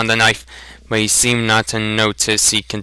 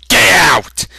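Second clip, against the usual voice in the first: loud, much higher furious order; demanded; 9 semitones higher, 6 dB louder than usual. Acted. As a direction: bark it out. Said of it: "demanded Sloane".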